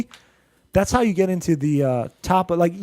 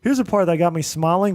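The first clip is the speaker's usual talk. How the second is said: usual speaking voice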